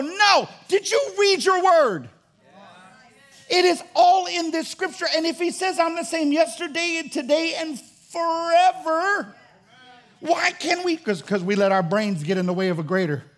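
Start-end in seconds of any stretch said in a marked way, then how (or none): 0.0-2.1 high, loud and slow, in disbelief; 1 incredulous shout. Acted. Preacher challenging doubters, loud incredulous cry. 3.5-11.0 high, loud, slow preaching; preaching at full force. Acted.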